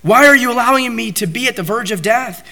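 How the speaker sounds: high, pleading cry to God; a desperate plea to the Lord, voiced high. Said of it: "he's saying".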